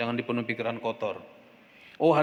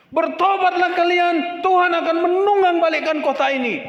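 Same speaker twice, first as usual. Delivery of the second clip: teriakan nabi yang keras dan tinggi; Yunus berteriak; 9 dB louder than usual. Acted. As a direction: serukan seperti nabi di tengah kota. Yunus berseru kepada kota. (seruan lantang Yunus kepada kota Niniwe)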